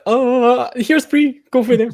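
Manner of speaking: high, airy mock-casual voice. Host teasing the writer with a breezy mock voice. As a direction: toss it off like it's nothing. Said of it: "or were you just like".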